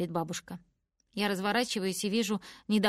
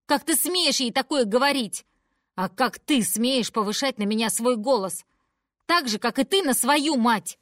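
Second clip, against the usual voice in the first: loud, high outraged shouting; furious yelling; 4 semitones higher, 7 dB louder than usual. Acted. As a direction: yell back in rage. Daughter-mother quarrel. (громкая яростная ссора)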